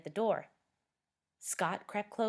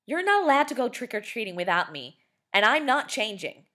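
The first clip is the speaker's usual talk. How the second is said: angry; 6 semitones higher, 9 dB louder than usual. Acted. Scott arguing with sister.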